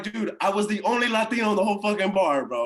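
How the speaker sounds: high, loud exclaiming retelling; worked-up realization. Retold or imitated. Storyteller realizing his missed chance at the bar, worked up.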